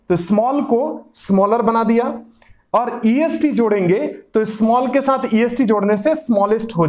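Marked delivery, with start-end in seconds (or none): none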